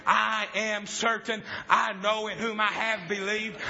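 usual speaking voice

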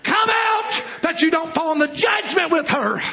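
high cry easing down in pitch; high, held opening cry, then falling; urgent preaching shout. Real, not acted. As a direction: shout the warning to the congregation. Preacher shouts warning to congregation.

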